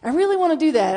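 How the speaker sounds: really pushed up high and loud. Shown as a demonstration. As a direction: blurt the wish out eagerly. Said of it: "I'm terrified".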